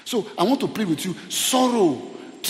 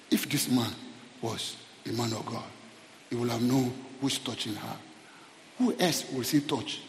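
low, much softer quoted remark; hushed disapproval; 7 semitones lower, 10 dB quieter than usual. Retold or imitated. Preacher quoting Simon about the weeping woman, hushed disapproval. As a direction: imitate a quiet aside, well under preaching level.

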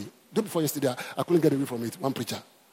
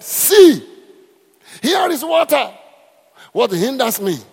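shouted high and loud; eunuch's eager shout; excited shout; 9 semitones higher, 9 dB louder, slower than usual. Retold or imitated. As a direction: cry it out like a man who found water. Eunuch's cry retold in sermon.

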